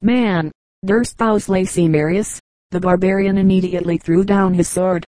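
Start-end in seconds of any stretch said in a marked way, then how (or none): none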